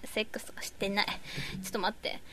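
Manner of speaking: embarrassed whisper. Real, not acted. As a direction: hush it, almost no voice. Embarrassed aside under breath.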